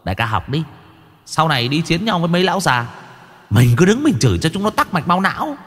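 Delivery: gleeful henchman marvelling to his boss; impressed underling with a giggle; awed sidekick. Acted.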